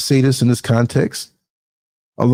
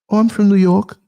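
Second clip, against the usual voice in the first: bright, higher put-on New York accent, overplayed; a mock New York accent, a touch higher; fake New York accent; 8 semitones higher than usual. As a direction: mimic a woman faking a New York accent. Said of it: "her accent was just off".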